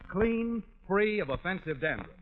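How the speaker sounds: usual speaking voice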